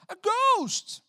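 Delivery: high, loud scream of fright; terrified cry. Retold or imitated. Frightened disciples crying out at a ghost, screaming. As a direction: shriek at the ghost. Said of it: "they said".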